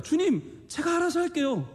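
high, self-assured protest; protesting believer. Acted. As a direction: pitch it high and insist you can manage alone. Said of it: "수없이 항변합니다".

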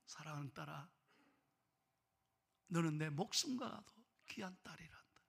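hushed, soft, loving address; tender mother. Acted. Mother telling daughter she is precious, tenderly.

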